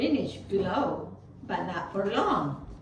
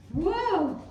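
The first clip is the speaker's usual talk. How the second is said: surprised; 10 semitones higher, 4 dB louder than usual. Acted. Surprised exclamation.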